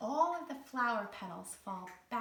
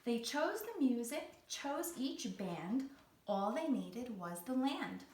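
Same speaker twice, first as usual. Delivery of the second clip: lively rhyming storybook read; reading to children; 3 dB louder than usual. Acted. Teacher reading a rhyming picture book aloud to kids. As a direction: bounce the rhymes for young listeners.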